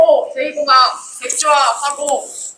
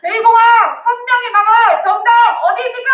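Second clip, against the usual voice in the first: rally call; 8 semitones higher than usual. Real, not acted. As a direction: call to the crowd.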